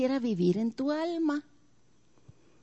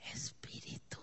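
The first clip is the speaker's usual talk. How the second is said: "espíritu" breathed out in a whisper; whispered ending; 21 dB quieter than usual. Acted. Preacher ending God's promise in a whisper. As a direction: let the last word fade into a whisper.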